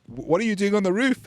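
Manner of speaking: retold shout. Host as journalist calling to Trump on the roof, mock shouting. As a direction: imitate calling up, no real volume. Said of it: "the journalist was shouting".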